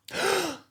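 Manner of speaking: high, sharp intake of breath; mock-horrified gasp; shocked gasp. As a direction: gasp as if appalled. Shock at being asked her language.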